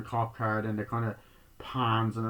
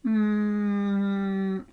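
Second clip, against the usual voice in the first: long, high, steady "mmm"; imitated hum; 10 semitones higher than usual. Maxine answering in the film, imitated held hum. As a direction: hum one held note in reply. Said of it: "And then she goes".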